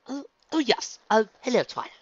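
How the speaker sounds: flustered, high, loud introduction; high-pitched voice. A narrator's reading. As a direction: blurt it out in a squeezed high voice. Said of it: "got out in a high-pitched voice".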